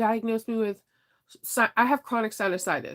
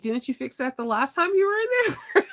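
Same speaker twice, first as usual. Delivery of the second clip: half-laughing, incredulous question; laughing mock question; 9 semitones higher than usual. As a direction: laugh through the question in disbelief. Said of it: "I'm like".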